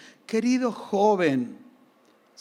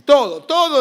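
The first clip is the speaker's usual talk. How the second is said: wife's bossy insistence, louder and higher; wife insisting; 6 semitones higher, 7 dB louder than usual. Retold or imitated. Wife urging the child to finish, mock insistent. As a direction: push it like a mother demanding a clean plate. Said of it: "decía mi esposa".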